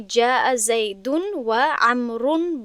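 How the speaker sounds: جاء زیدون و عمرون read slowly with nunation; full vowelled reading. Shown as a demonstration. Teacher reading the fully vowelled Arabic example. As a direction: sound out the case endings carefully. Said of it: "در اینجا باید".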